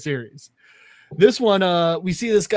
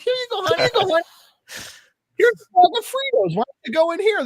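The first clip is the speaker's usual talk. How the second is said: very high-pitched mock mother; mom voice; 13 semitones higher, 4 dB louder, faster than usual. Mom offering her boy Fritos in car, high mom voice. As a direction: pipe it up as a cartoon mom. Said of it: "his mom's like".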